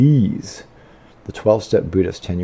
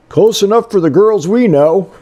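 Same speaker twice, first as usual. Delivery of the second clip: put-on high, louder folksy old-timer voice; imitating ex father-in-law; 11 semitones higher, 6 dB louder than usual. Quoting his ex father-in-law's joke, high and loud. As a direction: mimic the old man's cheeky saying. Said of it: "father-in-law used to say".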